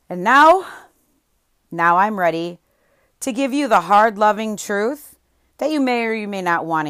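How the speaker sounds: rally them for the hard truth; pumped-up coach call to attention. Real, not acted.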